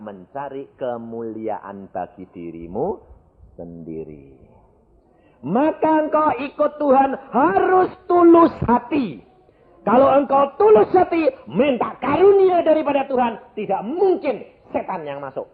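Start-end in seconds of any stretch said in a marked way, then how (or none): 5.4-15.5 high, forceful sermon exhortation; fervent preaching. Real, not acted. Preacher exhorts the congregation to be sincere, fervent preaching. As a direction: urge the congregation with pitched-up fervour.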